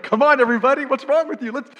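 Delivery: high, very loud hammed-up rallying shout; exuberant rallying. Fired-up worshipper to the rest of the church, exuberant shouting. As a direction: rouse a sleepy congregation at full voice.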